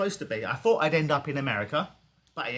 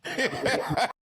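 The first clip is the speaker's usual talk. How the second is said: laugh; 9 semitones higher than usual. Real, not acted. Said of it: "That was my Southern accent".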